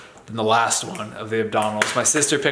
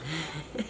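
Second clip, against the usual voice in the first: quick quiet chuckle; short breathy laugh; 6 semitones higher, 14 dB quieter than usual. Real, not acted. Laughs at own joke.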